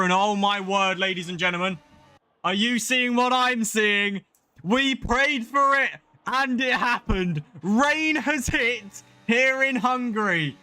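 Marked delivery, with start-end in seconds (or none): 2.4-10.6 thrilled race caller, words stretched for drama; excited commentary. Real, not acted. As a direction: announce the rain with real, building excitement. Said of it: "hear the excitement in my voice".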